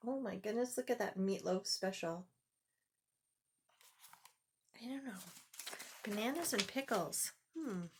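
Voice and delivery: hushed aside. Real, not acted. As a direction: confide your doubt quietly. Reader aside, hushed and doubtful.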